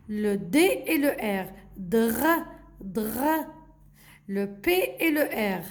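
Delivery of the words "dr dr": "dr" with a long throaty R; blended consonants. Shown as a demonstration. Teacher repeats for the children, blended consonants. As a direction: sound out "dr" as one blend. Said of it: "il faut juste savoir comment prononcer".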